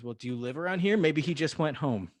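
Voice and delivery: hammed-up officer's brush-off; cop. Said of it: "the cop's answer is".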